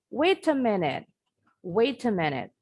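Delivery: British wait a minute, the T of wait kept as a clear T; British true T. Shown as a demonstration. Teacher demonstrates the British wait a minute after the American one. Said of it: "Kalau orang Inggris bilangnya".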